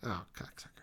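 hushed, breathy curse under the breath; a real muttered curse at the dice; whispered curse. Real, not acted. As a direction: curse it to yourself so the table barely hears. Frustrated at a bad roll.

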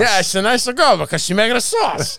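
high, shouted Italian-aunt agreement; mock Italian accent. Agreeing about the wife's sauce, shouted mock Italian accent.

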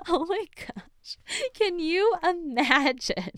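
high, drawn-out words through laughter; laughing disbelief. Real, not acted. Podcaster reacting to the mother's courtroom outburst, laughing helplessly. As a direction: squeal it out while cracking up. Cracks up after the yelled quote.

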